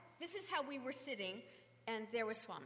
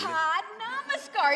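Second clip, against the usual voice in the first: slow, loud, insistent; emphatic; 9 semitones higher, 13 dB louder, much slower than usual. Retold or imitated. Swami loudly insists the singers bow. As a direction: spell it out firmly and slowly. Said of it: "he goes".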